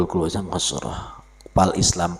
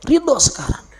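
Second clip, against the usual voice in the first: high call; 5 dB louder than usual. Real, not acted.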